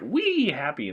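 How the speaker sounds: high, stretched-out gleeful squeal; mock a giddy cheer; sing-song fake happiness. Mock cheer at a sad film.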